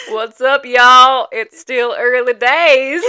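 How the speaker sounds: loud, slow mock-Southern drawl; hammed-up Southern with laughter; Southern accent attempt. Shown as a demonstration. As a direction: holler it in a jokey Southern accent. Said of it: "Do I sound Southern?".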